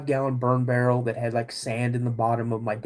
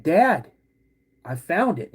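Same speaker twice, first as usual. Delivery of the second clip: louder, higher call to dad; retold yell; 4 semitones higher, 5 dB louder, slower than usual. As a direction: call out as if across the woods. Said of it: "I yell to my dad".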